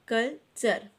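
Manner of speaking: कल्चर broken in two, कल, pause, चर; split into syllables. Shown as a demonstration. Teacher showing children the two syllables of कल्चर, syllable by syllable. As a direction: say कल्चर as two separate beats. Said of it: "तो ये two syllable".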